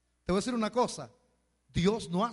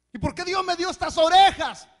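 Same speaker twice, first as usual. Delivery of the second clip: mock complaining; 10 semitones higher, 11 dB louder than usual. Mocking a complainer to God.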